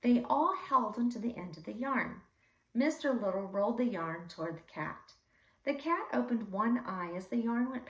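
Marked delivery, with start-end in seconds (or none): none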